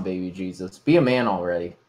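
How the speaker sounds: ordinary talk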